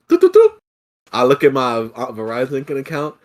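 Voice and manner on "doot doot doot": high, loud beeping doots; typing noise. Retold or imitated. Agent fixing the account, sung doot noises. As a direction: mimic keys clicking away.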